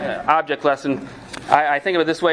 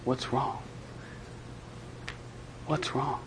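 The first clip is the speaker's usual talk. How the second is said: puzzled murmur; 3 semitones lower, 16 dB quieter than usual. Retold or imitated. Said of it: "you're staring at the house going".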